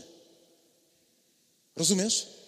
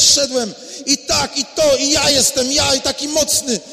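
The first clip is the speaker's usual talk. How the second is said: loud, proud lecturing from the pulpit; smug preaching; 5 semitones higher, 4 dB louder than usual. Acted. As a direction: preach down at them, full of yourself. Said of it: "a Piotr by stał i mówił".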